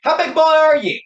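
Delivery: mock Southern holler. Co-host joining the Missouri bit, loud high mock holler. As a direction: holler it like a hammed-up Southern challenge. Joins in mimicking Southern threat.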